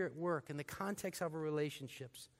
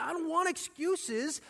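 angry master; 10 semitones higher, 6 dB louder than usual. Retold or imitated. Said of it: "He's like".

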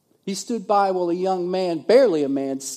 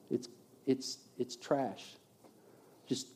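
low, much softer, halting; quiet dismissal; 4 semitones lower, 12 dB quieter than usual. Real, not acted. Preacher writes off Paul's resume, quietly dismissive. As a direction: let it drop away softly, writing it off.

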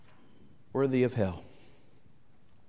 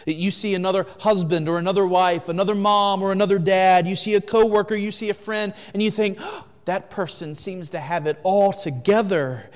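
louder and higher, driving; full-force preaching; 6 semitones higher, 8 dB louder than usual. Acted. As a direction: preach it to the back row.

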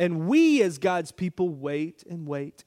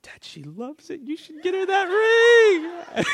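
put-on high pleading kid voice, much higher; child voice; 11 semitones higher, 4 dB louder than usual. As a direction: beg like an eager little girl. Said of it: "she goes".

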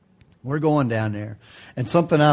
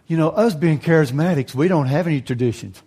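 ordinary delivery

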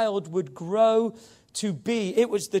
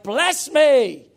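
loud, high-pitched emphatic cry; shouted plea; 7 semitones higher, 9 dB louder than usual. Acted. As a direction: shout it out in all caps. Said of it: "like you're shouting it out".

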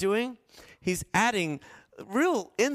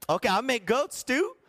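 high cheery offer; 7 semitones higher than usual. Retold or imitated.

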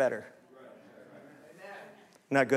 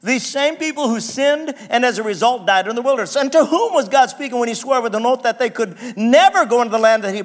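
raised, louder preaching voice; 5 semitones higher, 4 dB louder than usual. Acted. Pastor preaching to the church, raised and forceful. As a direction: preach it out to the congregation.

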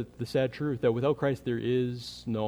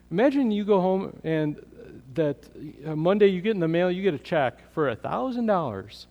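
excited; 6 semitones higher, 5 dB louder than usual. Acted. Excited sermon illustration.

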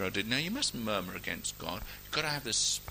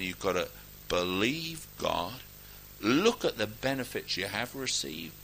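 normal talking voice

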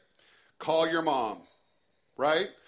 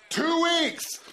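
mock outrage; 11 semitones higher, 4 dB louder than usual. Mom's sarcastic complaint, mock outrage.

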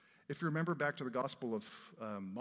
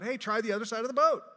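louder, higher call across the water; yelling from shore; 8 semitones higher, 10 dB louder than usual. Acted. Jesus yelling from the shore to the fishermen, loud and high. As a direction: shout it out to a boat far offshore. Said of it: "So he yells out".

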